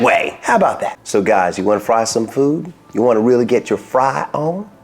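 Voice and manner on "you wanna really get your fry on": ordinary delivery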